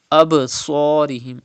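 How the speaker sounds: "Absarihim" with an echo after b; qalqala. Shown as a demonstration. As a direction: bounce off the b in "Absarihim". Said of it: "make an echo sound".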